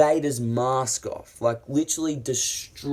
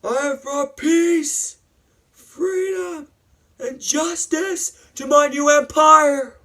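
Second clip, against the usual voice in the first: slow, very high, loud proclamation; hammed-up strained shouting; acted grandiose rant; 8 dB louder, slower than usual. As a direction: declaim it like a crazed conqueror. Acts out Anakin's grandiose declaration.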